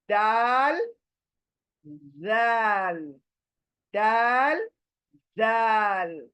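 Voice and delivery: दाल and जाल held long in Arabic sounds; Arabic letter names. Shown as a demonstration. Teacher contrasting two dotted letters. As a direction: say दाल, जाल slowly for the class. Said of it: "फिर दो letter देखे हमने".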